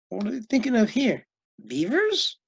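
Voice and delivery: usual speaking voice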